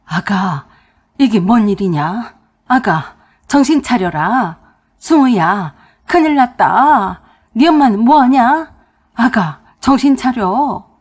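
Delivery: loud, drawn-out cries of alarm; panicked shouting. A narrator's reading. Father-in-law to collapsed daughter-in-law, panicked shout. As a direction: call out urgently to rouse someone who collapsed. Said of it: "아버님이 비명을 지르고 난리가 났습니다".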